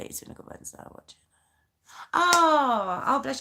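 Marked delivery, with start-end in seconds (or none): 0.0-1.2 whispering. Real, not acted. Whispered aside to someone nearby.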